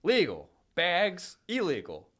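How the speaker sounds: loud, slow, punchy contrast; emphatic. Real, not acted. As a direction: spell out the irony loudly. Mocking the new bag law.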